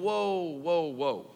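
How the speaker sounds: imitating Mary's startled whoa; a light, retold whoa, whoa; mild mock alarm. Preacher voicing Mary's shock at the angel, lightly startled. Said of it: "See, Mary's going".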